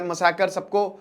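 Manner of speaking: normal talking voice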